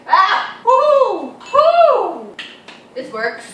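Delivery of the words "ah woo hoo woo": yelp, then high loud whoops; shrieking whoops. Real, not acted. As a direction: whoop wildly at the shock. Scared, cold ketchup hits the arm.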